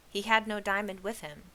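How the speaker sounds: usual speaking voice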